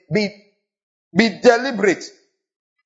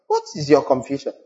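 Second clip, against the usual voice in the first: ordinary talk, nothing marked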